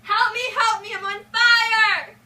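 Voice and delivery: high, loud screams for help; burning scream. Acted. Burning king screaming from his tower. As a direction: shriek as the flames take him.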